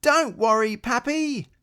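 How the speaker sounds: high, loud reassuring call to Papa. Acted. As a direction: call down to calm your father. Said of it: "Little Rabbit called".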